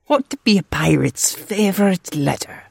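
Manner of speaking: high, loud pirate riddle. Acted. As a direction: pose the riddle like a playful pirate. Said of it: "Talk Like a Pirate Day".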